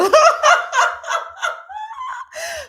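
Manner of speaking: long high loud cackling laugh; long high cackle. Real, not acted. Cracking up at Sean's North Dakota joke, long cackle. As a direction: let a long cackle ring out.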